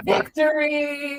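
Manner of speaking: drawn-out victory cheer through laughter; laughing, slow singsong cheer; mock triumph. Listener cheers the retold win.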